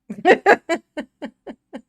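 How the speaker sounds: loud cackling laugh. Real, not acted. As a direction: burst out laughing. Laughs at own joke.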